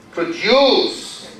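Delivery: "Produce" stressed on the second syllable, pro-DUCE. Shown as a demonstration. Teacher shows the verb before asking the rule, "Produce" stressed pro-DUCE. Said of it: "So what's the rule here".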